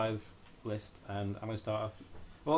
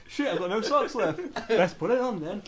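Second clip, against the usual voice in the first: laughing; 11 semitones higher, 6 dB louder, slower than usual. Real, not acted. Laughing fit over smelly socks.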